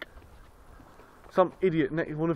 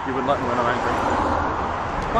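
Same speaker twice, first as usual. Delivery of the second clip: mock menacing movie line; 9 dB louder, much slower than usual. Jokes about how fun his anger is.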